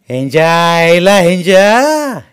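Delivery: loud, very slow drawn-out curse; cursing. A narrator's reading. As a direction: curse under the blanket, drawn out. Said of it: "एक शिवी हासडली".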